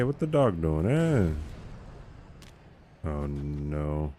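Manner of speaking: ordinary talk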